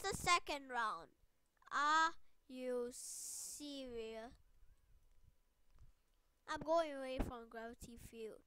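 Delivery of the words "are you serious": very slow, drawn-out disbelief; deflated disbelief. Real, not acted. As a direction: stretch each word out, incredulous. Same words, deflated after losing.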